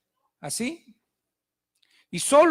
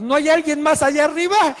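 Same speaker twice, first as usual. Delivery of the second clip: loud, higher call into the sky; comic shout upward; 5 semitones higher, 9 dB louder than usual. Acted. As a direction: shout up hoping for someone else. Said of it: "empieza a gritar".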